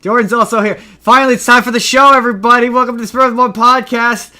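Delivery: loud, high, pumped show opener; hype announcer. Acted. Hyped show intro.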